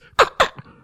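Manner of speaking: loud crackly static made with the mouth; imitated walkie-talkie noise. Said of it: "his walkie-talkie would make noises".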